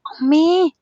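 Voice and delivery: a sudden whispered cry of pain. A narrator's reading. Husband hit on the chin by his wife, pained whispered cry. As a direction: gasp out amme as the chin is struck.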